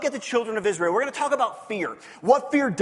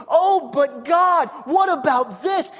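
drawn-out, slow, a touch louder whine; mock pleading; 4 semitones higher, 4 dB louder, slower than usual. Pastor voicing himself complaining to God, mock pleading. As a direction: plead like a worried child.

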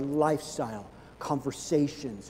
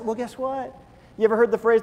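ordinary talk

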